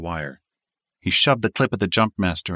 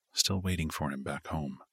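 ordinary delivery

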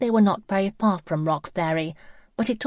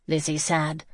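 ordinary delivery, nothing marked

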